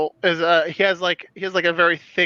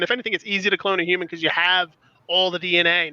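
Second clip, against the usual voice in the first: ordinary delivery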